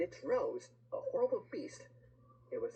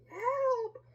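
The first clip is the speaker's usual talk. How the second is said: very high, loud long cry; storybook shriek for help; 7 dB louder than usual. A narrator's reading. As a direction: call out for rescue as the witch. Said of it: "cried the witch".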